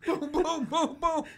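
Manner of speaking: high, loud boom boom; gunshot noises. Pretend. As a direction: mimic shotgun blasts. Mimicking gunshots.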